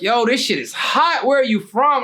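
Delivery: wowed and eager to know; retold admiration. Rapper admiring jacket, questioning him.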